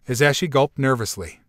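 ordinary delivery

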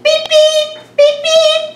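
very high loud beep beep; car beeping. Shown as a demonstration. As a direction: honk like a little car. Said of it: "honk when you go beep".

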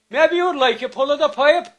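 little man voice. Retold or imitated.

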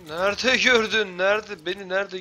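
high, loud and incredulous; exasperated. Real, not acted. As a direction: protest loudly in disbelief. Upset at being spotted.

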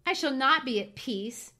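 lightly acted anguished lament; moaned. A narrator's reading. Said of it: "moaned Cathy".

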